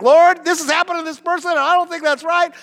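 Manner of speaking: mock complaining prayer; complaining to God. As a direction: whine it up to God like a child. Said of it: "'Cause I'm like".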